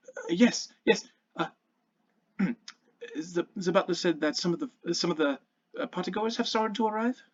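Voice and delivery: a lightly voiced son squaring up to report; nervous young boy.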